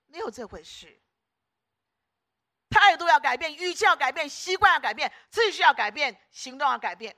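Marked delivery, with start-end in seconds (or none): 2.7-7.1 loud, high rallying chant; shouted exhortation. Real, not acted. Preacher urging congregation to change, shouting. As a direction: shout each demand out to the crowd. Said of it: "我这样喊了半天".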